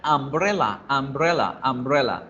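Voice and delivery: "umbrella" opening on an A sound; initial A sound. Shown as a demonstration. Teacher shows students the U read as A, "umbrella" with initial A. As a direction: say "umbrella" starting with A, not U. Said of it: "se pronuncia con A".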